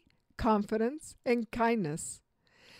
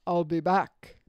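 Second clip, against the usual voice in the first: low, clipped Terminator line; Arnold Schwarzenegger; 3 semitones lower, slower than usual. Shown as a demonstration. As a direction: deliver it like the Terminator. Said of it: "How much better is".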